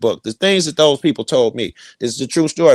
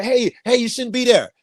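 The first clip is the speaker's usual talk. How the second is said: much higher mock warning; high warning call; 11 semitones higher than usual. Stranger warning a man off the spot, in a much higher voice.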